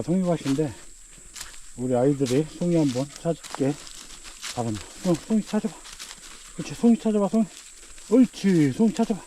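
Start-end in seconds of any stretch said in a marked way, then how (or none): 5.1-9.2 much higher, praising dog-talk. Real, not acted. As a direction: cheer the dog on like a puppy. Training dog to find mushrooms.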